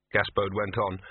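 usual speaking voice

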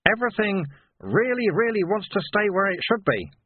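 high, emphatic explainer; patient Sweeper. A narrator's reading. Sweeper trying a simpler explanation on Vimes, high and patient. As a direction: lean on "really, really" in a high voice. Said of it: "Sweeper tried".

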